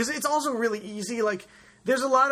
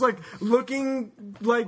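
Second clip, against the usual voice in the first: usual speaking voice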